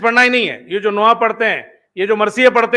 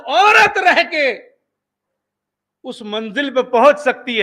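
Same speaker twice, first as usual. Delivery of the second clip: impassioned preaching; 5 semitones higher than usual. Acted. Preacher exhorting the gathering, impassioned full voice. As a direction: preach it from the pulpit, building. Preacher drives home his point.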